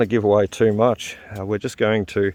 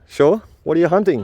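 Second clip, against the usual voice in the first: much higher, louder amused question; amused surprise; 7 semitones higher, 4 dB louder than usual. Real, not acted. Dad reacts to his son's spear plan, amused surprise. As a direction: play along, surprised.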